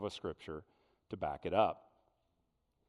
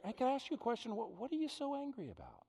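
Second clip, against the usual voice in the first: gentle caring question; 12 semitones higher, faster than usual. Acted. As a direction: draw him out kindly. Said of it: "He, he's rather".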